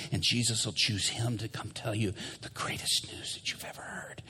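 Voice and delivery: hushed, intense whisper; urgent whisper. Retold or imitated. Prisoner urging Robbie to tell his story, whispered. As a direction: press the secret on him quietly.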